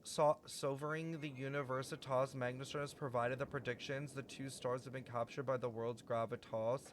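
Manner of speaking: formal reading. Acted. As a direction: read it like an old prophecy. Reading game text aloud.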